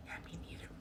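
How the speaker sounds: conspiratorial whisper. Retold or imitated. Driver whispers back agreeing with the passenger. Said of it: "I was like".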